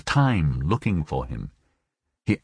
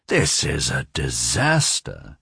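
slow, low pronouncement of doom; heavy, stretched-out despair; obsessed man, dismayed; 7 semitones lower, slower than usual. A narrator's reading. Narrator voices José Arcadio's despair.

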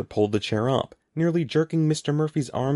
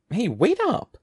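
high-pitched call after someone; 11 semitones higher than usual. A narrator's reading. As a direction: call out to make them wait. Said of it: "Andy called".

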